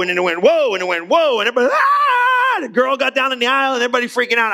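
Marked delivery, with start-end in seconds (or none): 0.4-0.7 louder, higher startled whoa as the plane dips. Retold or imitated. Plane drops again. 1.1-1.4 startled whoa. Retold or imitated. Plane drops third time. 1.7-2.6 retold scream. Everybody screaming on plane.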